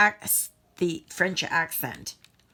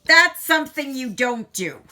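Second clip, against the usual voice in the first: higher and louder, a rueful scolding warning; 7 semitones higher, 9 dB louder than usual. Real, not acted. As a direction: call it out as a real warning to viewers. Warning after spilling beads.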